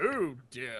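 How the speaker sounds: low, soft mock dismay; drawn-out low groan of dismay; imagined weary reply. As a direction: play it deep and resigned. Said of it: "And you'll be like".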